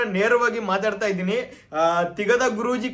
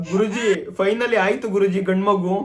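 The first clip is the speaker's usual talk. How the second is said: usual speaking voice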